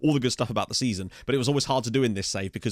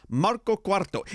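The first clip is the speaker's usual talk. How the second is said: relished Italian accent, pitched higher; bad Italian accent; 8 semitones higher, slower than usual. Shown as a demonstration. Gamer invites friends to say it along, playful Italian accent. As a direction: savour the name with an Italian lilt. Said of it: "doing some bad Italian accent".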